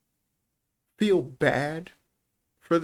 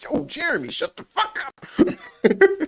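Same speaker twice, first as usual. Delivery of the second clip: high, laughing mock yell, slow on the last words; hollering at the kid who won't shut up, cracking up; yelling at a classmate; 13 semitones higher, 4 dB louder, much slower than usual.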